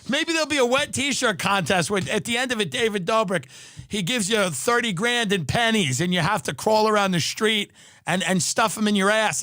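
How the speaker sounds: ordinary delivery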